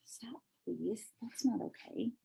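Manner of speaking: whispered request to stop. Real, not acted. Parent hushing child during reading, whispered.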